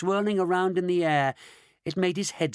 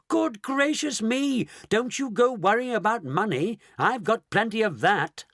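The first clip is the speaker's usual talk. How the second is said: amused surprise; 6 semitones higher than usual. A narrator's reading. Mister Wonka laughing off the money worry, higher and mildly surprised. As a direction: brush off the worry with gusto. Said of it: "cried Mister Wonka".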